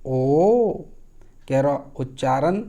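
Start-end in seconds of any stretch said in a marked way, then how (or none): 0.1-0.7 ओ held loud and long; a rubbed Angika ओ; Angika o sound. Shown as a demonstration. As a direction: stretch the ओ. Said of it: "आपको आवाज़ बदलना होगा".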